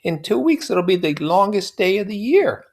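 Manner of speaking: put-on high, airy young woman; lilting society girl; high voiced Daisy; Daisy's voice. A narrator's reading. Daisy voiced in read novel.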